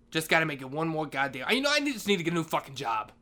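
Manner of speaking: usual speaking voice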